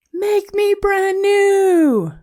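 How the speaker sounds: very high, slow, strange puddle voice; a wavering little voice rising from a puddle. A narrator's reading. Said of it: "A voice from the puddle said".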